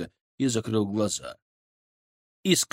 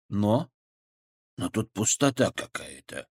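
touch of bewilderment; 3 semitones lower than usual. Acted. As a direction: lose your footing a little. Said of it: "в его голосе появилась нотка растерянности".

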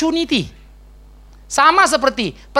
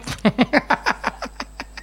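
breathy laugh; 2 semitones higher, 6 dB quieter than usual. Real, not acted. Tertawa geli.

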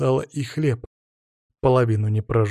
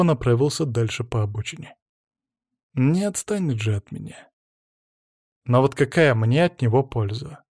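ordinary delivery, nothing marked

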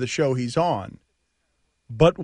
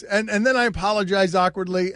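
ordinary talk, nothing marked

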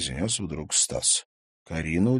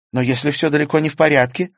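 put-on higher young girl's voice, rueful; girl Karina voiced; 8 semitones higher, 8 dB louder, faster than usual. A narrator's reading. Karina admits her foolishness to Stas, higher girl's voice. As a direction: play Karina, higher and lighter, sheepish.

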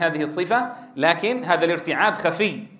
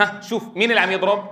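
usual speaking voice

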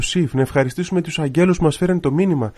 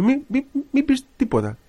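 hushing a puppy; 11 semitones higher than usual. Shown as a demonstration. Priest shows listeners how we hush a barking puppy, high cooing shush. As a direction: coo at a puppy to stop it barking. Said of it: "κάτι σκυλάκια που πάνε να γαβγίσουν".

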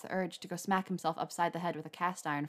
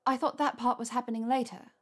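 flustered Hermione; 5 semitones higher, 3 dB louder than usual. A narrator's reading.

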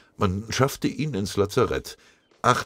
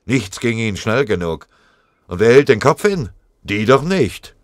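indignation building to loud, higher outburst; angry thought; 4 semitones higher, 7 dB louder than usual. A narrator's reading. Sergeant fuming about those in the rear, anger building. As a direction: grumble that builds into angry protest. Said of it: "dachte Scharf wütend".

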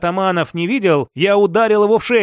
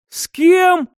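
sudden high shout of disbelief; jolted, it bursts out before thinking; blurted in surprise; 10 semitones higher than usual. A narrator's reading. Captive blurts at Sapphire, high startled shout. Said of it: "вырвалось из меня".

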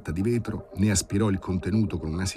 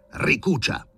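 roared order; 6 semitones higher, slower than usual. A narrator's reading. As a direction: bark the order to stitch. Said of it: "ruggì".

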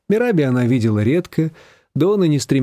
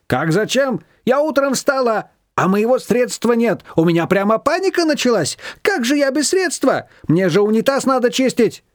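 higher, agitated voice; fussy neighbour; 10 semitones higher than usual. A narrator's reading. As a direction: fret about the missing cleaner. Shushana explains in panic.

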